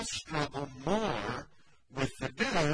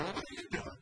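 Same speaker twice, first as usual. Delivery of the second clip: suspicious muttered ask; 4 semitones higher, 8 dB quieter, faster than usual. Shown as a demonstration. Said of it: "There is a time where you can say".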